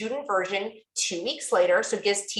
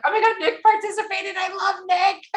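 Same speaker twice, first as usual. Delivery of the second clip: imitating her own much higher gushing squeal; fangirl gush; 10 semitones higher than usual. Retelling her reaction to Nick joining, high mock squeal. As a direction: replay the starstruck squeal. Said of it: "I'm like".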